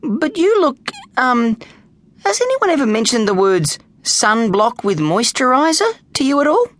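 put-on high, hesitant boyish voice; cheeky boy. Snag advises an old-looking gladiator, hesitant and high. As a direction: tactfully fumble the insult.

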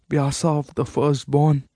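a lightly voiced old woman naming her son's title; dying old queen. A dying mother names her son by his title, lightly rasped. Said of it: "her voice the feeble and hoarse rasp".

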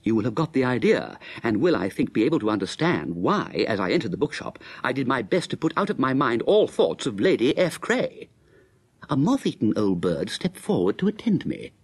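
brisk first-person narrator's telling; story narration. A narrator's reading. Narrator Bertie recounting his walk to the bookshop, brisk telling. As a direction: tell it straight to the audience.

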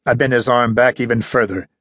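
ordinary delivery

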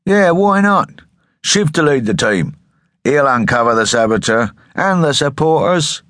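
high, eager calling out; loud, high-pitched enthusiasm; enthusiastic crowd. A narrator's reading. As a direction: call it out like an eager crowd member. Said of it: "took up the idea with enthusiasm".

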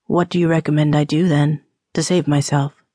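low, quick man's voice, playfully challenging; teasing rogue. Acted. Drinker asking temperance woman for salvation, teasing and low. Man teases about salvation.

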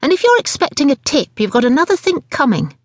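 high-pitched indignant threat; snappish customer. A narrator's reading. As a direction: tell the waitress off crossly. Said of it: "Missus Angry snapped".

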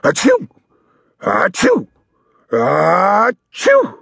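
three loud high sneezes; sneezing. A narrator's reading. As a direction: sneeze it out big. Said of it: "and he sneezed".

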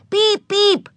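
high-pitched horn imitation; honking. A narrator's reading. Little girl imitates a trolley bus, honking. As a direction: honk like a toy bus horn. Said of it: "honked Ramona".

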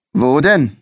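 toying with him, grinning; curious grin. A narrator's reading. Second policeman curiously questioning the boy, grinning. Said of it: "mit einem neugierigen Grinsen".

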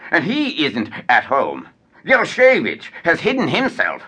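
usual speaking voice

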